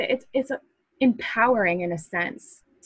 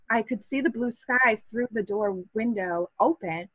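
ordinary talk, nothing marked

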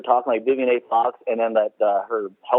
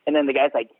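usual speaking voice

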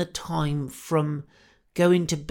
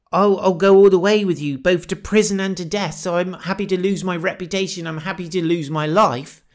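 louder and higher, rushing the vow; a bold promise blurted out with zeal; Peter's brash vow of loyalty; eager boast; 5 semitones higher, 7 dB louder, faster than usual. Retold or imitated. Peter's boast quoted to Jesus.

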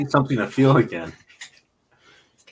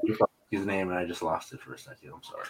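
ordinary delivery

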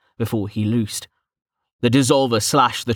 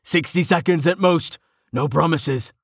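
raised, higher-pitched battle call; pitched-up shout without full volume; shouted over noise; 5 semitones higher than usual. A narrator's reading. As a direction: call out a quick warning to a teammate. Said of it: "Tilson shouted over Val's howls".

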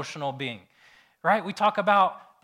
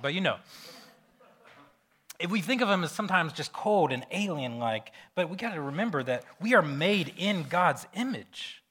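normal talking voice

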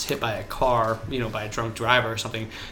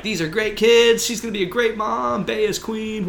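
gushing media voice; 11 semitones higher, 3 dB louder than usual. Retold or imitated. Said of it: "all of a sudden it was like".

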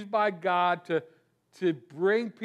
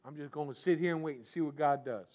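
low, soft, quick sulky mutter; a quiet pouting aside, faster; 7 semitones lower, 6 dB quieter, faster than usual. Retold or imitated. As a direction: grumble it under your breath like a sulking prophet. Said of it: "sits on a mountain and pouts".

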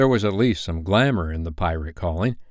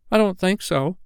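put-on high-pitched little boy's reply; first twin; 11 semitones higher than usual. First twin copying the others' clever answer, high boy voice. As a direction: pipe it up like a small boy. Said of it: "said the first twin".

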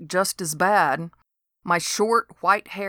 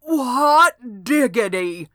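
put-on slow, higher folksy old man; amazed old neighbour, slowed right down; Dode's exclamation; 5 semitones higher, 5 dB louder, slower than usual. A narrator's reading. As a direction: draw out his delight. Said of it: "said Dode".

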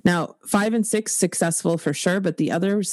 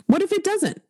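emphatic high; 13 semitones higher than usual. Real, not acted. Emphatic repeat of hopeful question.

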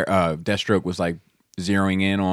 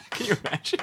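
laughing; faster than usual. Real, not acted. Laughing falsetto aside.

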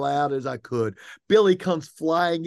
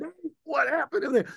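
yelling for the goalie coach, much higher; retold scream; 11 semitones higher than usual. Coach calls across the dugout for the goalkeeper coach, high mock yell. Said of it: "I'm over there screaming".

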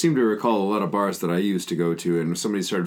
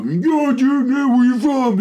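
high, loud mock bro voice; obnoxious stranger; 6 dB louder, slower than usual. Some guy coming up at a bar, obnoxious. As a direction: imitate a bro hollering at you. Imitates drunk guy approaching.

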